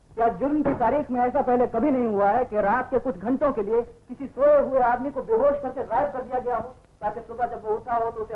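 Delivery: ऊँचे सुर में जोश भरी दलील; सवाल पर सवाल दागकर अपनी बेगुनाही जताओ; ज़ोरदार सफ़ाई. Acted. Accused pleading innocence forcefully. (आवेश में दलील)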